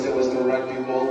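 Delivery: ordinary delivery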